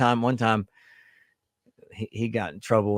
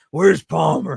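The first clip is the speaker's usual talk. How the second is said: raised, louder mock demand; pushy boyfriend; 5 semitones higher, 6 dB louder, slower than usual. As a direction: lightly play a demanding boyfriend. Playing the boyfriend demanding the daughter.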